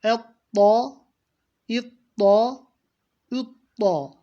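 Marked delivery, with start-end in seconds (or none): none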